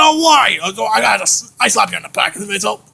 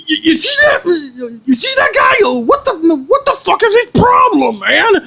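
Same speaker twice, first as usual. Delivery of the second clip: high-pitched sputtering outrage; acted indignant complaint; exasperated rant; 6 semitones higher than usual. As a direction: vent in disbelief, voice jumping up. Rants about Big Al revealing everything.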